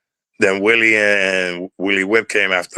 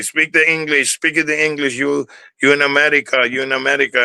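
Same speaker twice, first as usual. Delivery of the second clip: much higher mocking voice of a scolding teacher; mock strict teacher; 6 semitones higher than usual. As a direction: parody the teacher ordering a Spanish-speaking boy to speak English. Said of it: "And she would say".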